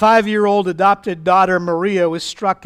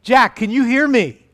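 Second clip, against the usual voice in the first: a lightly raised calling voice; voice from above; 4 semitones higher, 4 dB louder than usual. Acted. Unseen Lord calling down to Jack, lightly voiced call. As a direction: call down to someone far below, slightly higher. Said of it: "he heard a voice".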